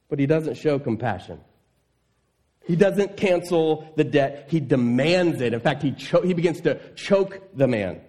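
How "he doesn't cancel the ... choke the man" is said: ordinary delivery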